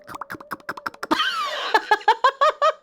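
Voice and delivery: high gleeful giggle. Real, not acted. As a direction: giggle at the joke. Gleeful laugh.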